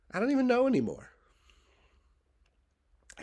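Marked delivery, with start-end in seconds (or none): 0.1-1.1 high, loud exasperated whine. Real, not acted. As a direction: throw up your hands vocally. Exasperated at his own confusion.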